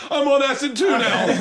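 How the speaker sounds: high, loud declaration through laughter; hammed-up high yell; mock distress. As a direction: imitate a guilty friend crying out. Acts Shane joining in, upset.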